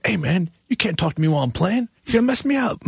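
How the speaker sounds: high-pitched, hammed-up complaint; mock indignant protest. Keyboard player to the production manager mid-song, mock indignant.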